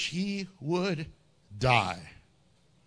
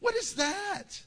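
usual speaking voice

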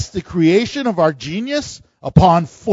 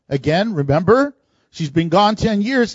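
ordinary talk

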